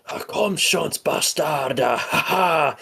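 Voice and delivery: excited high mock German yell with a laugh; imitated German yell. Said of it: "like, a German yell".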